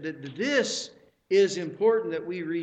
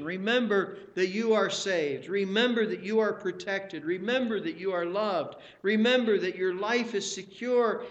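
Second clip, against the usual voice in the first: ordinary talk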